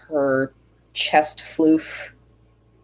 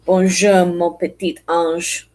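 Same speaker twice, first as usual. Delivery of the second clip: mock French accent, louder Bonjour; French phrase attempt; 3 dB louder than usual. Shown as a demonstration. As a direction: say it like a wannabe Frenchman. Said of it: "French accents".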